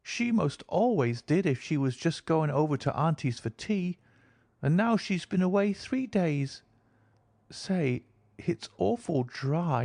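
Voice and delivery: put-on high, childlike voice of a thirsty girl; a small girl speaking innocently; little girl. A narrator's reading. Girl asks her companion for water, childlike.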